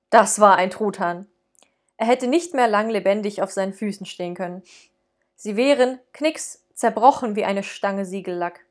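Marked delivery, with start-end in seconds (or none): none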